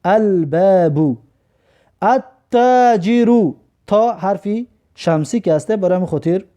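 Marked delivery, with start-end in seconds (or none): 0.0-1.2 "الباب" very slowly as al-baabu, L clearly sounded; L pronounced. Shown as a demonstration. Teacher demonstrating a moon letter, L pronounced slowly in "الباب". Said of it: "برام خاطر لام تلفظ کردیم". 2.0-3.5 "التاجر" very slowly as at-taajiru, doubled T; silent L. Shown as a demonstration. Teacher demonstrating a sun letter, silent L in slow "التاجر". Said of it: "لام تلفظ نکرده".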